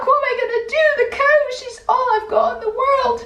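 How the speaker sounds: high, fast, panicky despair; a young man's high, fretful wail; distressed. Acted. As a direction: gabble it out in a panic. Jack despairs over his cow.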